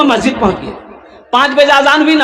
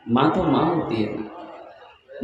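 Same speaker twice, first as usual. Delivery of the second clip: much softer, lower and very slow; tender, drawn out; 11 semitones lower, 12 dB quieter, much slower than usual. Shown as a demonstration. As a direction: linger on माँ तो माँ होती है ना as a tender sigh. (धीमे, कोमल, खिंचे स्वर में)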